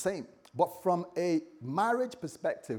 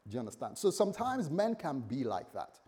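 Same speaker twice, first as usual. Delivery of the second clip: ordinary talk, nothing marked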